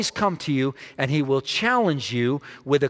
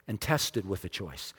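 quiet falling close; 8 semitones lower, 10 dB quieter than usual. Shown as a demonstration. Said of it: "repeated point".

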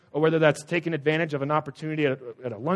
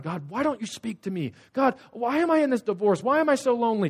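pleading prayer; 8 semitones higher, 4 dB louder than usual. Retold or imitated. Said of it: "he was actually praying to God".

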